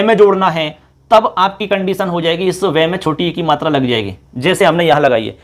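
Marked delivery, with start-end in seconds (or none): none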